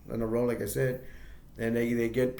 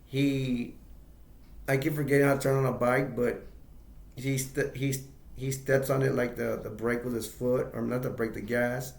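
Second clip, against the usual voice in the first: ordinary talk, nothing marked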